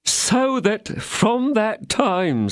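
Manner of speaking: high, fretful innkeeper. A narrator's reading. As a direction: pitch it up as the worried landlord. Innkeeper voiced by narrator.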